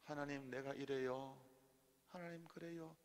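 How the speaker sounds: hushed, slow sample prayer; asking God. Acted. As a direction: confide softly, acting out a prayer. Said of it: "하나님께 한번 물어보세요". (느리고 나직한 기도 시범)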